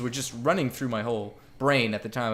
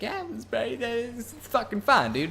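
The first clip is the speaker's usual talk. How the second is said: higher, slow, unbothered friend voice; carefree friend; 10 semitones higher than usual. Retold or imitated. Patel shrugging off the trouble, high and lazy. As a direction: drawl it out like nothing matters. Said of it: "he's just like".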